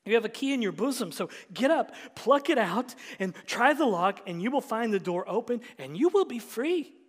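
loud, rising sermon climax; full-force preaching. Acted. Pastor proclaims Jesus' holiness, full-force preaching. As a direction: thunder it from the pulpit.

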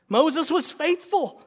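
Moses was faithful, WAS hit high and hard; emphatic. Acted. Emphatic restatement after argument.

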